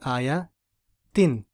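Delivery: आयातिन drawn out very slowly; slow demo word. Shown as a demonstration. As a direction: stretch आयातिन syllable by syllable. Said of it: "गोलता पे ख़त्म हो रहा है". (आयातिन धीरे धीरे खींच कर)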